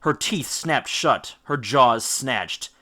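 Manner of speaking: usual speaking voice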